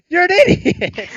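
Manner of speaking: high, loud, slow retold outburst; yelling at the friend who can't ski; exasperated insult. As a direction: let it burst out, drawn out. Insulting friend on bunny slope.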